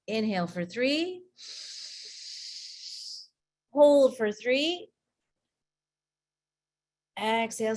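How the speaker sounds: slow counted breathing cues; guided breathing. Shown as a demonstration. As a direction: pace their breaths, calm and slow. Leads a breathing exercise.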